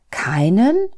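KEINEN rising high and loud; disbelieving question. A narrator's reading. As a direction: ask "Keinen?" in disbelief, swooping up at the end. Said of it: "konnte kaum glauben, was Gott da sagte".

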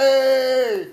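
excited shout. Retold or imitated. Excited greeting retold at cannabis cup.

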